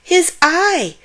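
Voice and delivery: put-on boy pointing at the dragon, shouting. A narrator's reading. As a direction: yell it out, pointing. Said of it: "He pointed and shouted".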